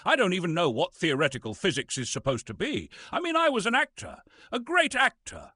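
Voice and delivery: rising high and wounded; indignant outburst. Acted. Bitter actor protesting to the listeners, indignant high cry. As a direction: insist on your greatness, voice climbing.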